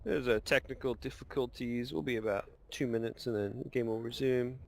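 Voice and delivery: lightly put-on pilot announcement; calm cabin PA read; airline pilot voice. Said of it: "Is that your airline pilot voice?".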